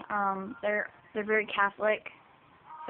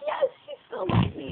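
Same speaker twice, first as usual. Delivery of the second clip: much higher, louder, breaking with tears; crying relief; 13 semitones higher, 3 dB louder than usual. Retold or imitated. Teen reliving mom's acceptance, high crying voice. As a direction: squeak it out through sobs of relief. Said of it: "I was bawling out in tears".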